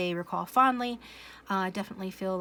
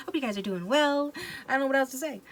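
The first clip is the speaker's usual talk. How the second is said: bright awkward intro; 6 semitones higher, 4 dB louder than usual. Real, not acted.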